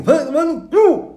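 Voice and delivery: high garbled grunts ending in a loud hoot; mute man's noises. Acted. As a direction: burst into high, wordless hooting.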